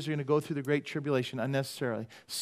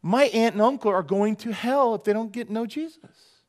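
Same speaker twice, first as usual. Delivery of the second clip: alarmed urgency; 7 semitones higher, 5 dB louder than usual. Pretend. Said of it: "I don't go".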